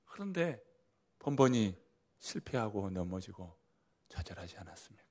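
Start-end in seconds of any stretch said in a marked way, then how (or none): none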